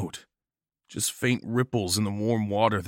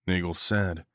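ordinary delivery, nothing marked

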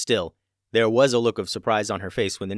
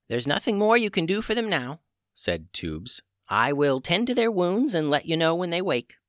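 put-on old witch voice; 8 semitones higher than usual. A narrator's reading.

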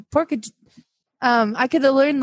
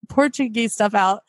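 ordinary talk, nothing marked

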